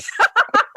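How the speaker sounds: high laugh. Real, not acted. Laughing at CD handout story.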